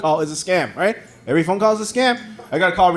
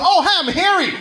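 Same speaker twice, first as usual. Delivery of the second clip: higher, airy party greeting; high mock introduction; 13 semitones higher than usual. Stand-up comic voicing a stranger introducing himself, mock high. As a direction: play the guy saying hello.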